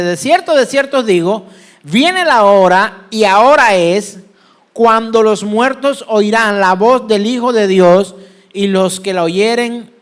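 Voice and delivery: loud, drawn-out declamation; scripture proclamation. Acted. Preacher reads John to congregation, loudly proclaimed. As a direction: proclaim the verse with pulpit force.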